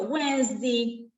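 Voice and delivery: Wednesday said slowly, every syllable drawn out; slow demo. Shown as a demonstration. Teacher to students, showing the W sound, Wednesday slowly. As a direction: stretch out Wednesday for the class. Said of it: "Palabras que sean con W".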